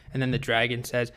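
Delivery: usual speaking voice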